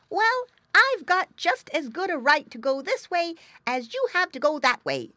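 high, loud indignant retort; arguing piglet. A narrator's reading. Younger pig answers his brother, indignant and high. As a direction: argue back hotly in a high piglet voice. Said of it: "answered the younger piggy Iggy".

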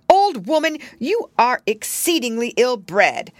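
high, loud, haughty scolding; horrified rebuke. A narrator's reading. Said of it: "much horrified".

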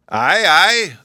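put-on a jolly old man calling out; hearty and cheerful; cheery. A narrator's reading. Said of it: "the professor cheerily replied".

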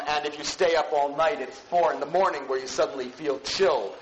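ordinary delivery

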